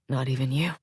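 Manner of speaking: low, soft and tender; softly. A narrator's reading. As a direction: say it softly, teasing gently. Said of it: "he says softly".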